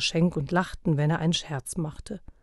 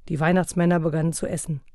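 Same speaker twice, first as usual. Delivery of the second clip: usual speaking voice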